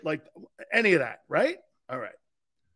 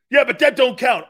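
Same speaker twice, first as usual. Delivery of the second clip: higher, louder indignant retort; mock defensive critic; 7 semitones higher, 10 dB louder than usual. Caller brushes off three divorces, louder indignant retort. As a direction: play the caller huffing that it doesn't count. Voicing critic's weak comeback.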